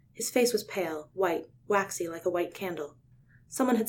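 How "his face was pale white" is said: ordinary delivery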